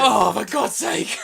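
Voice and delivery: loud, drawn-out groan of exasperation; fed up, loud and stretched; exasperated outburst. Real, not acted. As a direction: cry out in mock despair, dragging the words. Teased about more pictures to scan.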